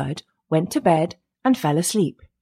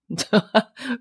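short mocking chuckle; a little laugh to himself; scornful chuckle; 4 semitones higher than usual. A narrator's reading. Elder brother amused at his foolish brother, scornful chuckle.

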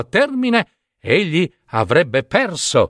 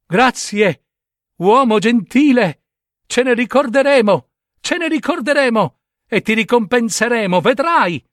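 high little fish voices, thankful; fish crying out; 9 semitones higher than usual. Acted. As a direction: call up gratefully from the water. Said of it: "i pesci gridarono".

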